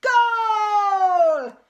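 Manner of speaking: long, loud, high victory yell; triumphant shout. A narrator's reading. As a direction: roar it out. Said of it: "he exclaimed".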